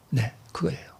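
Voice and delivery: whispered aside. Real, not acted. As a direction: confide it under your breath. Preacher whispers confirmation.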